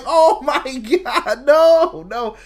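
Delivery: mock despair, drawn-out and loud; anguished quote. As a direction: act out the heartbreak of a lost match, laughing through it. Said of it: "And I'm like".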